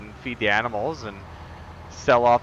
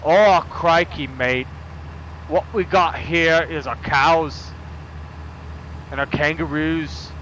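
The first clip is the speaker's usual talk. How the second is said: a hammed-up Australian accent, slow and louder; 4 semitones higher, 4 dB louder, slower than usual. Shown as a demonstration. Streamer attempting the Australian accent chat asked for, hammed-up Aussie. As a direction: play a cartoon Aussie farmer. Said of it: "I don't know that I can do monotone Australian".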